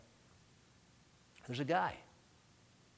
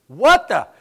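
a loud, high startled "what the"; cartoon bubble; 7 semitones higher, 17 dB louder than usual. Pastor voicing his stunned reaction to the seat-taker. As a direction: blurt out the shock in your head. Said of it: "my cartoon bubble is going".

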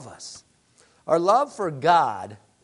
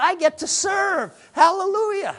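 much higher and louder, rising to a shouted "Hallelujah"; joyful exclamation; 11 semitones higher, 4 dB louder than usual. Acted. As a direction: burst out with joy, climbing to the praise. Preacher rejoicing over serving.

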